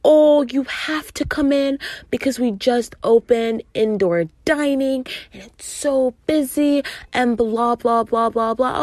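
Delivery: imitating a tiresome coworker; dismissive mimicry. Mimics coworker pleading, trailing into blahs.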